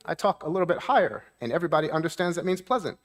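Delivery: lifted, pleasant and a bit quick. Shown as a demonstration. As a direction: raise the pitch to sound friendly. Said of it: "when I'm trying to sound pleasant".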